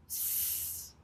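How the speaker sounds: 'S' as a long soft hiss; single letter sound. Shown as a demonstration. Teacher going back through the word, isolated hissed 'S'. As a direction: sustain 'S' alone with no vowel. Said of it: "say this sound".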